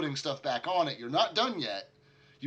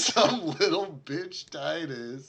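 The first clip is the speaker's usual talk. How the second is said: slow, raised-pitch parroting; teasing mock echo; 4 semitones higher, 4 dB louder, slower than usual. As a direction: drag out the misheard insult in amusement. GM parrots misheard line, laughing.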